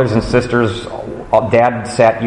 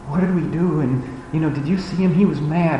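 put-on hushed, higher kid voices, conspiratorial; kids whispering; 4 semitones higher, 7 dB quieter than usual. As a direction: whisper like kids afraid dad will hear. Kids whispering after dad's talk.

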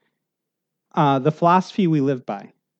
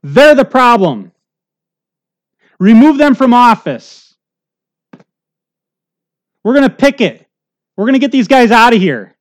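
a mock protest yell, pitched up and loud; yelling crowd; 5 semitones higher, 8 dB louder than usual. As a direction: rouse the mob against the officials. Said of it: "We yell out in our flesh".

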